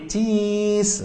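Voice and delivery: "cheese" said high and slow through a smile; grinning photo "cheese"; smiling cheese. Shown as a demonstration. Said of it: "itu bibir kita tersenyum".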